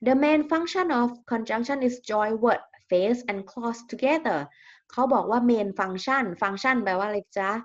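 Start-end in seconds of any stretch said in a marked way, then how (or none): 0.1-4.4 very slow, word-by-word reading for students; slow dictation reading. Shown as a demonstration. Teacher reading a definition to her class, slow and deliberate. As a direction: spell it out slowly so the class can follow.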